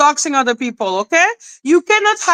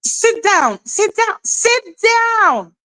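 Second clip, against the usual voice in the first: higher each time, rising sit down; mocking dismissal; 7 semitones higher than usual. Real, not acted. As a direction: shoo her off with climbing pitch. Telling the streamer off.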